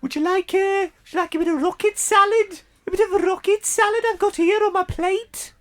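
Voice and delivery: very high, put-on Birmingham voice; squeaky high Brummie offering salad; Brummie accent. Man offers rocket salad in a very high Brummie accent. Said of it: "Stop, Brummy".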